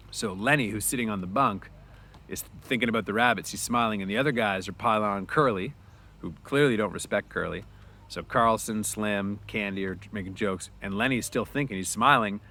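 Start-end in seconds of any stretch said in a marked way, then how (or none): none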